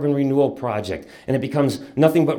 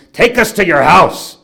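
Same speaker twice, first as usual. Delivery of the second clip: loud, raised-pitch retold command; stern father's order; 5 semitones higher, 10 dB louder than usual. Father to the captured boy in back, loud command. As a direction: bellow it as an order.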